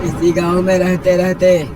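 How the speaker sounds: high, emphatic uncle voice; village elder marvelling. Acted. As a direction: declare a divine revelation to the village.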